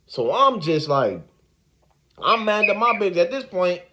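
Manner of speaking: ordinary delivery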